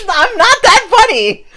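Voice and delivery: high laughing protest. Real, not acted. Laughing at own joke.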